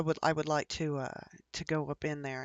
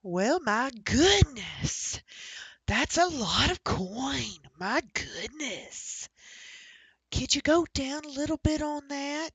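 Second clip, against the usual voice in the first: put-on old lady; 6 semitones higher, 6 dB louder than usual. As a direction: ham up a shocked old granny haggling. Old lady haggling in character.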